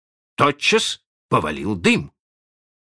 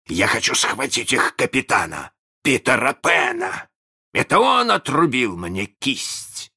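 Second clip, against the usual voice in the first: raised, fervent pirate captain; passionate; 6 semitones higher than usual. A narrator's reading. Hook to his bosun about Peter Pan, passionately. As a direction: speak with burning grievance against an enemy. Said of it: "говорил Крюк страстно".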